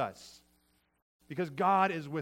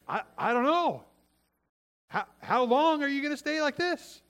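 high, loud, imitated comeback; mock exasperation, pitched way up; exasperated mom; 10 semitones higher, 5 dB louder than usual. Mom answering her sulking kid, exasperated. Mom throws the question back.

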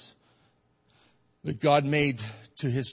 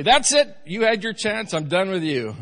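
mock dismissive God; 6 semitones higher, 6 dB louder than usual. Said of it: "Aren't you glad that God doesn't just say".

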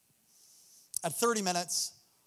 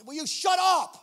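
annoyed judge; 8 semitones higher, 4 dB louder than usual. Retold or imitated. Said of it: "He's like".

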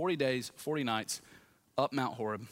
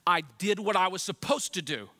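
pitched-up, louder protest; indignant Elijah; 6 semitones higher, 5 dB louder than usual. Acted. Preacher voices Elijah defending himself to God, indignant. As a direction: insist loudly with mild indignation. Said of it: "That's Elijah's way of saying".

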